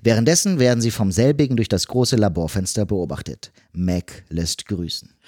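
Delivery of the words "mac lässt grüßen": usual speaking voice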